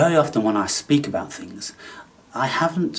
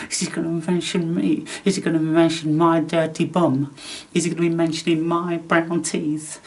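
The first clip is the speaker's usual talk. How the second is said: higher, anxious mock questions; mock worried viewers; 6 semitones higher than usual. Voicing viewers dreading he'll mention them, higher and fretful.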